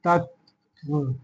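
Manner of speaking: soft, slow dictation of तत्व. Shown as a demonstration. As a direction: say तत्व slowly, letting the half त be heard. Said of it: "आधा त में भी त जुड़ा रहता है".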